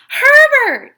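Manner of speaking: very high, very loud shout; boy shouting to his found dog; joyful shout. A narrator's reading. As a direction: yell with joy. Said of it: "he shouted".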